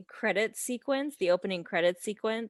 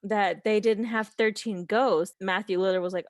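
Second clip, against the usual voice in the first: normal talking voice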